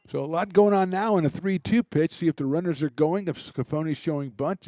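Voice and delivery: brisk, lively baseball call; live play-by-play. Real, not acted. Radio announcer calling the inning for listeners, lively play-by-play. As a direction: keep the game moving for listeners.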